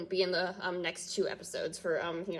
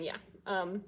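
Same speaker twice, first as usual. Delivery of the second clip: normal talking voice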